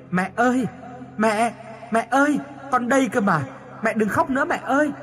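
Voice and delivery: high-pitched, desperate cry to mother. A narrator's reading. Dead daughter calling to her grieving mother, crying out. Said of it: "Con gào lên".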